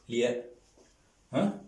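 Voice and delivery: ordinary delivery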